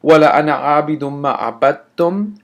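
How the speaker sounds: chanted recitation line; recited quote. Shown as a demonstration. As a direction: recite the verse in passing.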